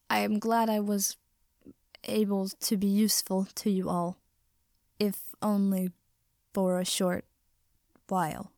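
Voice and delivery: halting voice, broken by pauses; creaking, persisting. A narrator's reading. Rusting Ida telling friends he was useful, creaky and halting. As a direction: push the words out as if seizing up. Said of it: "Even his voice had begun to creak, but he persisted".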